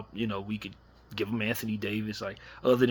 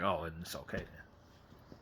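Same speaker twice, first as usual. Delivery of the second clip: slow, soft, suddenly unbothered; a hint of mock calm acceptance; mock shrug; 4 semitones lower, 9 dB quieter, slower than usual. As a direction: drop to a quiet slow "oh, fine then". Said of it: "then it's like".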